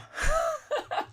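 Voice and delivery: squealing laugh; high-pitched laugh. Real, not acted. Laughing at own joke.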